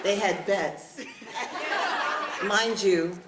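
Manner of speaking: real laugh while teasing the crowd; amused burst of laughter. Real, not acted. Laughing at crying bet.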